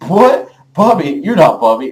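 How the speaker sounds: retold shocked double take; loud disbelief. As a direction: act out stunned denial, starting high. Disbelief at beast man's claim.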